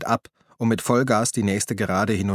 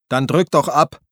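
loud, higher yell of defiance; defiant shout; 5 semitones higher, 6 dB louder than usual. A narrator's reading. Injured Harry yells defiantly at the tank, loud. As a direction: dare the tank to fire. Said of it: "schrie Harry dem Panzer entgegen".

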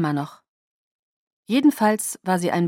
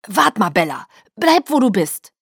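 raised voice from the next room; loud, hurried warning; urgent call; 3 semitones higher, 6 dB louder than usual. Acted. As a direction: call out to stop someone coming in. Father calls from another room.

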